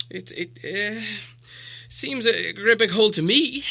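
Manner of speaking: high, stammering squeak; squeaky hobbit. Acted. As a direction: squeak it out nervously. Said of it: "squeaked Bilbo".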